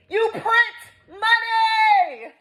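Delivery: very high, very slow wail; drawn-out howl of outrage; outraged wail. Real, not acted. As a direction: stretch each word into a high exasperated cry. Outraged repeat, stretched into wail.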